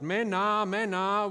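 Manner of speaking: shepherd yelling to his sheep; shepherd's call. Retold or imitated. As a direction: call the flock to follow you. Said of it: "he yells out".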